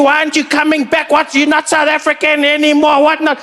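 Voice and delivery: high, outraged fan voices; mock the outraged online South Africans; angry fans. South African fans scolding Trevor online, high mock outrage. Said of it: "People lost it".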